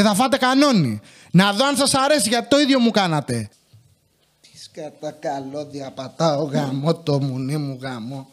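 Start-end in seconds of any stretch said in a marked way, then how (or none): 4.4-8.3 low, slow, much softer swearing; swearing quietly at tangled cables; cursing under breath. Real, not acted. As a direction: grumble curses to yourself after tripping on cables. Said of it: "Πολύ βρίζω σήμερα".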